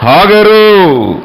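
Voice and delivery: slow, deliberate divine naming; God's calling voice. Acted. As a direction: stretch Hagar long as God calls. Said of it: "దేవుడు ఏం మాట్లాడుతున్నాడంటే".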